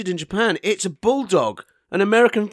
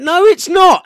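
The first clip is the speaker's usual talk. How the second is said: loud; 13 semitones higher, 9 dB louder, slower than usual. Real, not acted. Denies a teasing jab.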